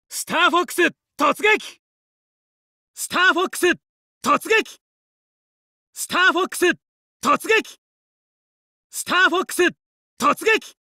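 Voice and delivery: high, ringing rally call; rally the team to charge; battle cry. Acted. Fox calling his squad to attack, high ringing battle cry.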